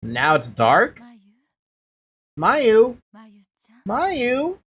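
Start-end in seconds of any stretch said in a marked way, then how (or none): 2.4-3.0 calling out. Acted. Mother calling daughter. 3.8-4.6 calling again. Acted.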